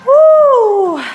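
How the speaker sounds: loud high whoop; excited woo. Real, not acted. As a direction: cheer the class on. Cheering mid-workout.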